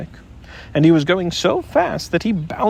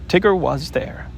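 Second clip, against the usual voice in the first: plain reading voice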